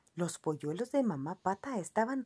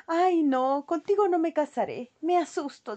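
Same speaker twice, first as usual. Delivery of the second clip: con desagrado; 8 semitones higher, 4 dB louder than usual. A narrator's reading. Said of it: "con mucho desagrado".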